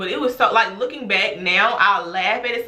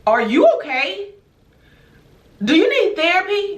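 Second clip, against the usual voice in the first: high, exaggerated questioning; incredulous mock concern; 10 semitones higher, 4 dB louder than usual. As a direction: ask it high, as if she has lost her mind. Acting out disbelief at the mother.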